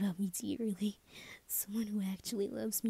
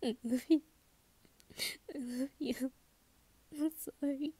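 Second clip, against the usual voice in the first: choked up, fighting back tears; tearful and denying it; 5 semitones higher than usual. Acted. Telling Chibi-chan I love you and sorry, tearful. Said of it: "I'm not crying".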